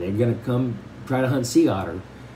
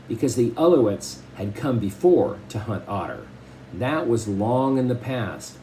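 even read-aloud storytelling voice; story narration; 4 dB louder than usual. Acted. Teacher reading a novel to the class, steady storytelling. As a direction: read the chapter to the class with care.

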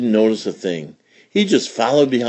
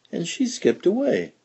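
usual speaking voice

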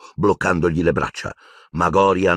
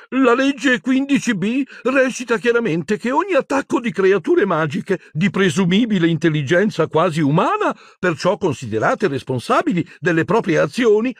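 high, haughty official voice; high, nervous bureaucratic warning; prim shrill Umbridge. Acted. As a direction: lecture them from on high. Umbridge reciting the law.

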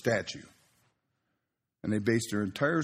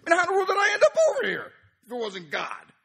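high, rapid outburst; retold amazed exclamation; impassioned realization; 4 dB louder, faster than usual. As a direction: burst out incredulously, racing through it. Reliving his shocked realization while preaching.

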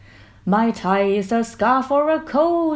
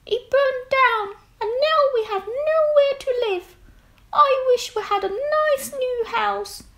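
very high squeaky mouse voice; squeaking mouse. Acted. Mother Mouse squeaking to George about her burnt house, very high. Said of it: "squeaked Mother Mouse".

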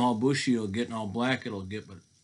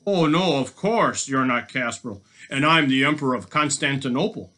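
loud scoffing disbelief; mock-agree with heavy sarcasm; storybook robber sneering at a fib; sarcastic; 4 semitones higher, 11 dB louder than usual. Robber scoffs at captive's claim.

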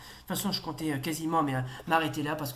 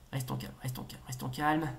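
soft, low murmur to oneself; self-calming; 5 semitones lower, 6 dB quieter than usual. Real, not acted. Player steadies himself in a tricky passage, self-calming. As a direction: keep it hushed and low, steadying yourself.

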